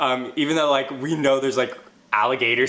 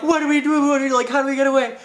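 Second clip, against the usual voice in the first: panicked; 10 semitones higher, 3 dB louder, faster than usual. Retold or imitated. Panicked friends stung by jellyfish.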